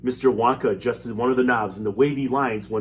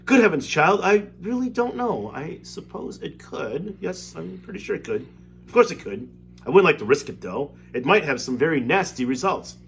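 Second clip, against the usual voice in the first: unsure Wonka; 7 semitones higher, 5 dB louder than usual. Acted.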